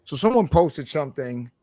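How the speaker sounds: usual speaking voice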